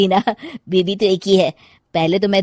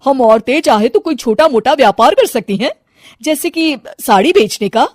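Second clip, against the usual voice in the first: higher and louder, full of enthusiasm; a bright, eager proposal, pitched up; 6 semitones higher, 6 dB louder than usual. Acted. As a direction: sell them the idea with excitement. Sudha pitches her business idea.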